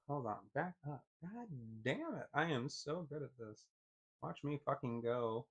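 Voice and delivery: dry muttering at own clumsiness; sarcastic mutter. Real, not acted. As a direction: mumble to yourself while fumbling. Muttering while fumbling controls.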